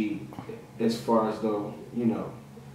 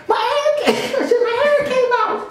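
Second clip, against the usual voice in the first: high laughing wail; panicked girlfriend. Retold or imitated. Imitating his panicked girlfriend, high and laughing.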